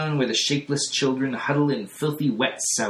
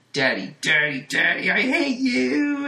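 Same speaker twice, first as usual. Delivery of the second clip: much higher and louder, hurled at him; furious scream; 12 semitones higher, 4 dB louder than usual. A narrator's reading. Jane finally turns on her father, screaming in rage. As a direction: scream your hatred at Daddy. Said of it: "crazy mad there".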